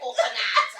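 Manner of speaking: "Coconut" tried in a laughing, loud fake Turkish accent; laughing Turkish accent attempt. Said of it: "I don't know what Turkish accent is".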